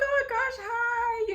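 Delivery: very high, loud squeal of fake delight; shrill mock-cheerful hi; flustered greeting. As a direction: gush a panicked hello. Bumping into the ex.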